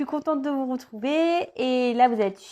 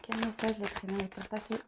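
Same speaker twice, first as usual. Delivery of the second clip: much softer murmured prayer, sliding into a whisper; whispered invocation; 2 semitones lower, 12 dB quieter than usual. Acted. Tarot reader pleading with her guides while shuffling, hushed whisper. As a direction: breathe the question to your guides.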